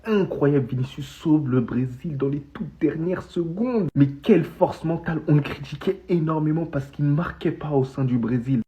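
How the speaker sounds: ordinary delivery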